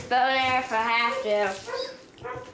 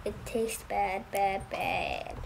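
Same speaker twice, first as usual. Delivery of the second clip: quiet; 6 semitones lower, 8 dB quieter, slower than usual. Real, not acted. Child complaining about medicine taste.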